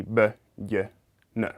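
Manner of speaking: "b, y, n" as separate sounds, not letter names; b, y, n as in ballena, each sounded alone; isolated consonant sounds. Shown as a demonstration. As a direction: sound out b, y, n one by one, with pauses. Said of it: "the first consonant with the second".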